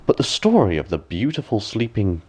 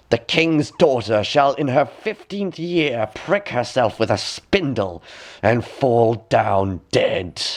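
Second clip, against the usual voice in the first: slow, higher cursing voice, barely louder; loud cry; 4 semitones higher, slower than usual. Acted. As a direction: pronounce the curse slowly, a mild mock cry. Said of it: "she cried with a loud voice".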